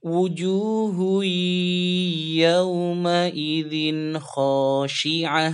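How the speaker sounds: وجوه chanted high with held tones; Quran recitation. Shown as a demonstration. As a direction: melodic tajwid recitation. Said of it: "Bacanya seperti ini".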